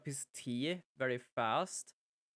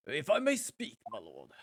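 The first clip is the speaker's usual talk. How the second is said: jury foreman voice; 2 semitones lower than usual. Acted. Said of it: "I forget to do his voice".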